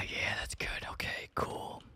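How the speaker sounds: breathless shaken whisper. Real, not acted. Whispers after the scare.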